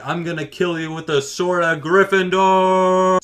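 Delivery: mock heroic threat, stretched out; threatening opponent. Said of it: "it's just like".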